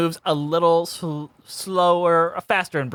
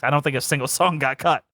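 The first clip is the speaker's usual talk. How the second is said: ordinary delivery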